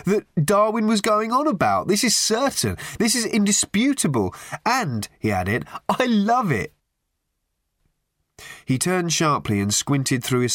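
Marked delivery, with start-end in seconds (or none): 0.0-5.1 put-on high, emphatic young pontificator; insistent. A narrator's reading. Youth to his dejected companion, insistent. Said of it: "he insisted to his thin". 5.9-6.7 high-pitched, fervent youth; emphatic. A narrator's reading. Youth capping his argument to his companion, emphatic. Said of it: "who was expostulating from his deck chair".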